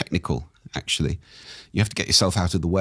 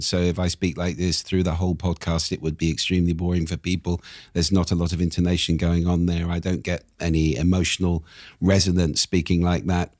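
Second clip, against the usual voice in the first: all on one tone, no intonation; monotone. Shown as a demonstration. Coach showing how boring a monotonic podcast would be, monotone. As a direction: drone evenly with no emotional resonance. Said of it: "that literally means one tone".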